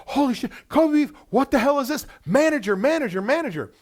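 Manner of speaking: high, loud, breathless rush of joy; hyperventilating with excitement. Retold or imitated. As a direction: shout for the manager, beside yourself.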